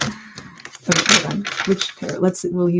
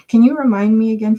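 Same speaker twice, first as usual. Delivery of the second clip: ordinary delivery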